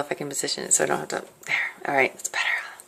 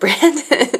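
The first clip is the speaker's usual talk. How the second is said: calling out; 10 semitones higher, 4 dB louder than usual. Retold or imitated. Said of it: "I just yelled out".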